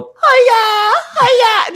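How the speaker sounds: very high loud wail; loon call. Retold or imitated. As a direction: toddler wailing like a loon. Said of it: "the three-year-old".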